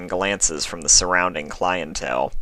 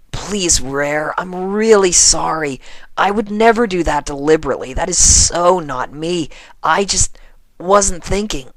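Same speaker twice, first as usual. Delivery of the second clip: high, loud earnest begging; pleading apology; 11 semitones higher, 5 dB louder than usual. Acted. Rainbow begging Rarity's forgiveness, earnest plea. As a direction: plead with her to forgive you.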